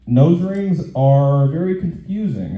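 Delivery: ordinary talk, nothing marked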